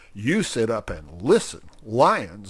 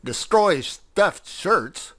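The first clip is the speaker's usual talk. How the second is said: high, loud and slow declaration; 8 semitones higher, 6 dB louder, slower than usual. Acted. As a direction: proclaim it to the whole hall. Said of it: "said old Wibbly in a loud voice".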